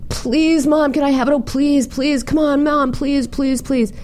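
high-pitched pleading kid imitation; begging little boy. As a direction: pester Mom in a high, insistent whine. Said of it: "begging his mother".

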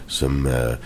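low, hushed second offer; mock Cloris Leachman. Actor retells the maid offering chocolate milk, low and hushed. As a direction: drop pitch and volume, hesitant offer. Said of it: "she turns very slowly again".

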